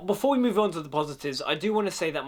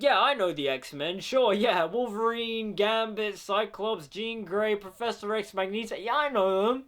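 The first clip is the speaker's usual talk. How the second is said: mildly raised, offhand rattling off names; dismissive, bored listing; 6 semitones higher than usual. Retold or imitated. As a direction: rattle them off like you barely care. Mock bored listing of X-Men.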